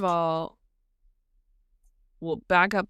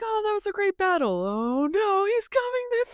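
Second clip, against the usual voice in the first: high mock chatter turning to alarm; relieved then panicked townsfolk; 12 semitones higher than usual. Townspeople seeing the dragon coming, high mock panic. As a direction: chirp happily, then squeak in fright, all pitched high. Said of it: "the people are like".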